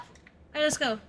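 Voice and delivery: ordinary delivery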